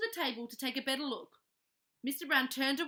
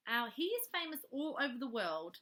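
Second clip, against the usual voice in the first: ordinary delivery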